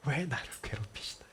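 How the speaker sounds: whispered complaint; soft whispered plea. Acted. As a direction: hush it like a secret grievance. Paraphrasing Job's complaint.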